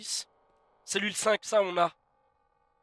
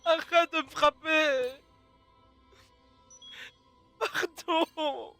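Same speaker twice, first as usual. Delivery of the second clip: scared pleading; 5 semitones higher, much slower than usual. Real, not acted. Being hit in the game.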